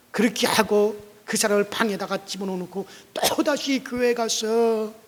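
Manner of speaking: ordinary talk, nothing marked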